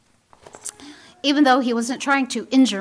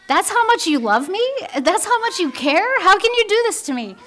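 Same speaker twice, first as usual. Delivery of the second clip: hurt mother; 8 semitones higher, faster than usual. Retold or imitated. Mother's hurt complaint to teen.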